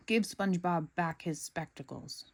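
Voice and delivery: plain reading voice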